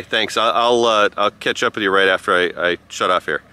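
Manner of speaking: normal talking voice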